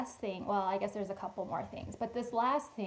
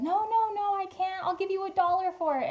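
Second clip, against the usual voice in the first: much higher, louder, pleading refusal; insistent lady; 10 semitones higher, 6 dB louder than usual. Retold or imitated. Lady in the cart refusing free towels, higher insistent protest. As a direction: voice the grateful lady pushing back.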